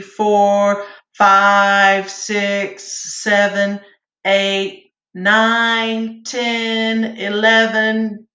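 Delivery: steady counted beats, one per second; rhythmic count-off. Shown as a demonstration. Choir teacher counting breathing beats for students, steady rhythmic count. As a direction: keep the beat for the class like a choir teacher. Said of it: "So we're gonna breathe in".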